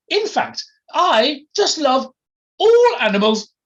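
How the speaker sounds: very high, loud and drawn out; posh, gushing aristocrat. Acted. Posh lady professing her love of animals, gushing. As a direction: gush it in an affected upper-class squawk.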